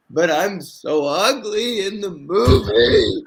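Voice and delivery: put-on high, slow, whiny kid's wail; hammed-up sobbing kid; mock crying kid. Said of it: "And I'm like".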